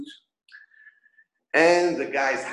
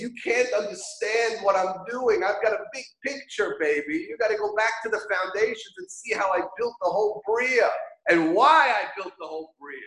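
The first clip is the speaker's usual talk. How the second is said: breezy God; 8 semitones higher than usual. Retold or imitated. Paraphrasing God's answer.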